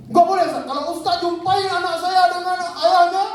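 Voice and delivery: mock outraged outburst, pitched high; acted angry refusal. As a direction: imitate an irate mother making threats. Mother refuses and threatens on phone.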